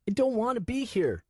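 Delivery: put-on high boy's voice, crying plea; crying child. Nico crying to Swen, high crying plea. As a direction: beg through tears. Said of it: "I wailed louder".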